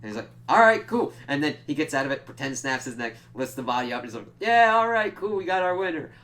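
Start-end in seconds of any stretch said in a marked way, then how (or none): 0.5-1.1 high, loud breezy okay; cheerful agreement. Retold or imitated. 4.4-6.1 loud, high crowd-pleasing call; showman announcement. Retold or imitated. Nandor announcing fake victory.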